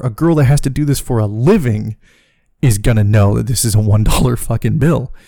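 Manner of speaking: ordinary talk, nothing marked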